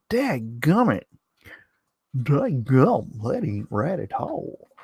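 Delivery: mock hillbilly accent, folksy grumbling; put-on country accent. Host grumbling about the denied ad in a put-on country accent. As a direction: do an exaggerated backwoods country accent. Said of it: "What was that accent?".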